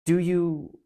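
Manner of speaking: steady storytelling voice, giving each speaker a light voice; reading a novel passage aloud to the congregation; story read aloud. Acted. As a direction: read it to the listeners like a well-loved book. Reads the Narnia passage aloud.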